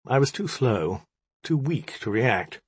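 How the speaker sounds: ordinary delivery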